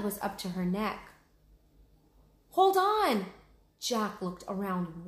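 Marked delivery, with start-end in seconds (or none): 2.5-3.3 much higher, louder cry of alarm; urgent shout. Acted. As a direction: yell to keep her holding on. Jack calls to sinking Annie.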